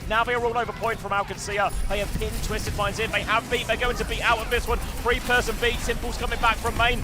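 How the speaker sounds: high, loud hype play-by-play; excited esports caster. Real, not acted. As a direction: call the fight with rising excitement. Hype casting of teamfight.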